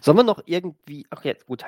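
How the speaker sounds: usual speaking voice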